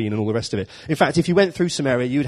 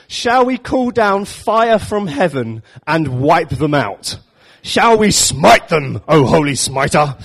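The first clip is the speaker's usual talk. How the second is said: slow, louder, higher, grand mock-pious plea; hammed-up zealous disciples; 4 semitones higher, 6 dB louder, much slower than usual. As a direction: ham up a pompous eager offer to smite. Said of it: "They say".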